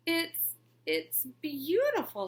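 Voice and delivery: slow, faltering fake praise; nervous stammer. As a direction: stumble over the lie. She fakes praise, stammering.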